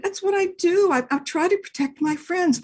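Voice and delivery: high, eager dog voice; earnest little dog. Acted. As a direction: explain earnestly like a small dog. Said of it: "Dog said".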